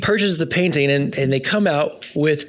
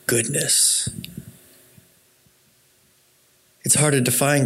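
guided reflection. Acted. Leads listeners in imagining beauty.

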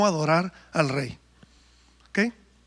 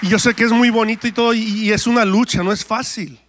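loud, driving preacher exhortation; preaching at full force; 2 semitones higher, 5 dB louder than usual. Acted. Pastor urges the congregation to applaud others, loud preaching. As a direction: call the church to celebrate others, full voice.

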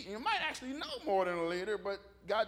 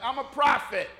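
playful boast; 3 semitones higher, 10 dB louder than usual. Retold or imitated. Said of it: "I'm like".

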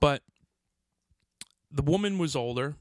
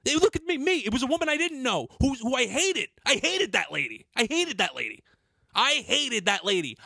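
worked up, high-pitched indignant rant; real boiling anger; 7 semitones higher than usual. Real, not acted. Recalling change thrown in a woman's face, real boiling anger. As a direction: get heated all over again while remembering. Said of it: "it's making my blood boil right now".

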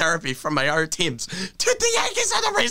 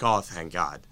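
relieved sigh; 12 semitones lower, 5 dB quieter than usual. Retold or imitated. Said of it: "I'd be like".

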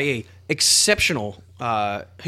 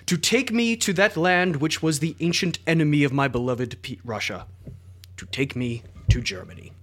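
put-on Russian accent. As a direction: read it like a Russian mystic. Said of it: "Your Russian accent is immaculate".

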